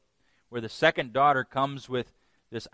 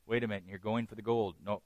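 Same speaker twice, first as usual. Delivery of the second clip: ordinary talk, nothing marked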